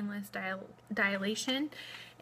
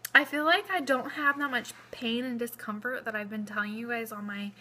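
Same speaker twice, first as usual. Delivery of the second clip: normal talking voice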